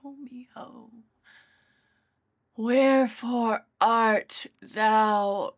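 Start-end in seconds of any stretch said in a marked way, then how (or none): 0.0-0.9 hushed longing. Acted. Longing call into the night.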